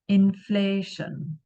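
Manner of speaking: inflation said slowly, in-FLAY-shun, long A on FLAY; slow model word. Shown as a demonstration. Teacher models the whole word for students: slow, in-FLAY-shun. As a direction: spell out inflation slowly, syllable by syllable. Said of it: "long A sound like bay".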